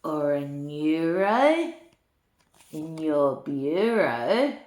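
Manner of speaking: lilting storybook read-aloud; reading to children. A narrator's reading. Granny reading a rhyming book to children, lilting. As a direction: read the rhymes to little ones with relish.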